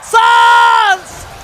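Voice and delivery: high, loud play-by-play yell; excited shout. Real, not acted. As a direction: call the save at full volume. Caster calling a teamfight.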